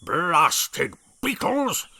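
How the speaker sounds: slow, loud blustering bellow; blustering bellow of an angry brig. A narrator's reading. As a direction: bellow it like a furious old officer. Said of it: "bellowed the brig".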